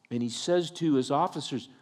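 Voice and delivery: usual speaking voice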